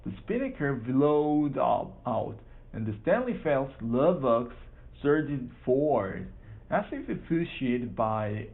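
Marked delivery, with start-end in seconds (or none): none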